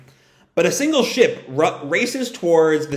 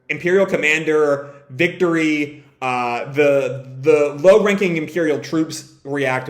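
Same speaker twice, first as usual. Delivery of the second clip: usual speaking voice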